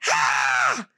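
high startled shriek; mock scared shriek. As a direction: shriek like you're terrified.

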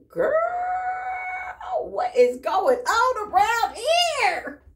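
high, loud and slow what is going on; high, loud, stretched shout of delight; excited outburst. Real, not acted. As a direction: cry it out, thrilled.

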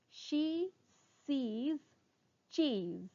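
word by word, long pauses between; slow tongue twister. Shown as a demonstration. Teacher introduces a twister to students, very slowly. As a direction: stretch and separate every word. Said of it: "first try saying them slowly".

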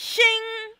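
high, bright vocal shing; mock sound effect. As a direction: voice a shimmering force field. Sound effect of force field.

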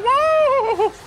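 sudden high-pitched yelp of surprise; shrieked whoa; startled whoa. Real, not acted. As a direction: jump out of your skin.

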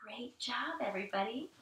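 very soft, gentle volume; hushed praise. Real, not acted. Teacher praising everybody after quiet breathing, hushed. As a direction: praise them without breaking the quiet.

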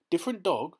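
jumps high and loud on Different dog?; startled cry. A narrator's reading. Feezy catching Claude's slip, a high loud startled cry. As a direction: pounce on Different dog? as if pricked. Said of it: "he cried".

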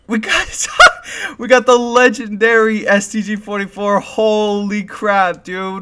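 high, laughing, near-crying excitement; overjoyed disbelief. Real, not acted. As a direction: voice stuck up high, overcome with glee. Reacting to second legendary.

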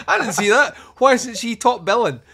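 high, loud, laughing disbelief; playfully incredulous reaction; mock astonishment. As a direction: exclaim it through a laugh. Acted surprise at actress being Danielle Harris.

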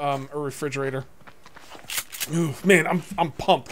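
ordinary talk

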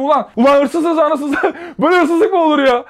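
high, loud furious cry; furious outburst. Retold or imitated. Narrator losing it at the woman who called him a thief, furious yell. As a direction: blow up at the accuser. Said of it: "Ben böyle üste çıktım".

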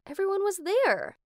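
plain reading voice

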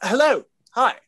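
high-pitched awkward hello; awkward greeting. Retold or imitated. Acting out his awkward first greeting.

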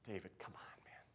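soft unvoiced whisper; hushed plea to David. Real, not acted. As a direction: whisper it, exasperated.

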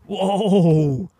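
a loud, high whoa; real amazed whoa at the vanishing worm; surprised whoa. Real, not acted.

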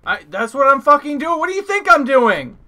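loud, high exasperated yell; annoyed shout. Real, not acted. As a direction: snap back loudly at teammates. Teammate nags, he yells back.

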